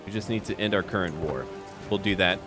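ordinary delivery

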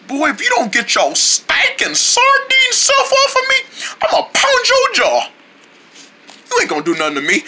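squeaky little fighter picking a fight; feisty fish. Acted. Fish threatens the sardine with a high laughing voice.

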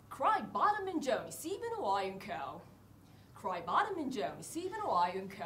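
slow fake English phrase; slow mock English delivery. Shown as a demonstration. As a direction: say it like an English sentence. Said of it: "田舎のおじいちゃん家なんかで言ってみてください".

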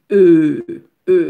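E held long and loud as a rounded eu; vowel demonstration. Shown as a demonstration. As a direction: sustain E on its own for learners. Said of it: "Se li menm ki E". (E long e fò, son eu)

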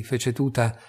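usual speaking voice

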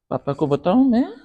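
high, loud rising question; loud threat. Acted. Parent threatening to tell papa, loud and rising. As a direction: press the threat at full voice.

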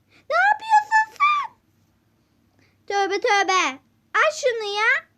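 screaming high and loud in panic; terrified scream. Acted. Customer screaming at the shopkeeper holding a snake, terrified shriek. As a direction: shriek as if the snake is coming at you.

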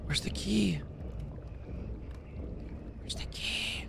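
unvoiced whisper, searching. Real, not acted. Gamer hiding from the monster, whispering. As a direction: whisper so the monster can't hear.

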